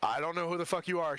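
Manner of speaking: ordinary talk, nothing marked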